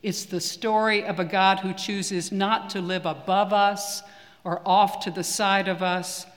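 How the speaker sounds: normal talking voice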